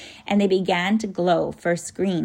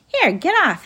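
high, loud startled yelp; 9 semitones higher, 6 dB louder than usual. A narrator's reading. Tom is surprised by the bird landing on him, startled. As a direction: blurt it in alarm, pitch jumping. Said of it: "he said in a startled voice".

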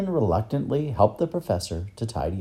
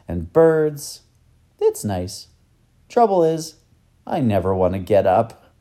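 a hint of a child's eagerness, louder; lightly voiced boy Tom, eager; 4 semitones higher, 5 dB louder than usual. Boy telling the professor what he hears, mildly eager. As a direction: lightly play an eager boy listing things.

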